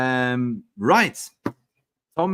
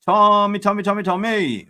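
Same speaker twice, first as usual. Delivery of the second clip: excited greeting; 6 semitones higher, 6 dB louder than usual. Real, not acted. Host greeting caller Tommy.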